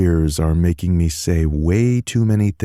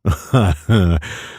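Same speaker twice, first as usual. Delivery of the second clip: head-back laugh; 3 semitones higher than usual. Acted. Tanner laughing at Miles's taunt. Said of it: "throwing his head back with a laugh".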